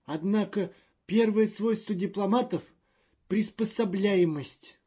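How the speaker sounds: high, loud and fretful; a jittery official in a panic; nervous diplomat voice. Acted. Magnan pompous line.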